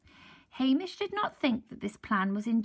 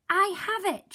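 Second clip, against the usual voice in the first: much higher, loud exclaim; 11 semitones higher, 7 dB louder than usual. Acted. Missus Grinling finding the answer, high exclaim. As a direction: burst out triumphantly. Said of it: "she exclaimed".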